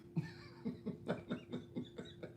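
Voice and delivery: quiet breathy laugh. Real, not acted. Laughs at viewer comment.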